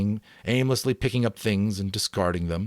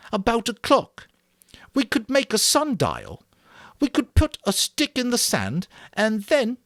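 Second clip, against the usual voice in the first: put-on high, earnest fat boy voice; a fussy, eager boy explaining; Piggy voice; 5 dB louder than usual. A narrator's reading. Piggy's voice in audiobook.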